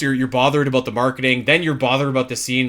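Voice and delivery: ordinary talk, nothing marked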